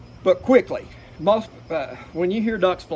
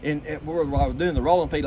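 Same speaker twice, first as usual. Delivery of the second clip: ordinary talk, nothing marked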